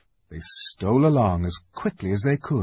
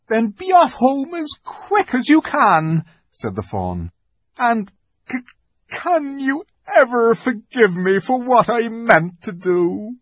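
high, slow pleading faun; a faun begging forgiveness; remorseful faun; 11 semitones higher, 6 dB louder than usual. A narrator's reading.

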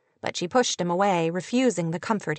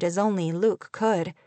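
ordinary delivery, nothing marked

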